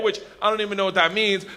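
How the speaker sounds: ordinary talk, nothing marked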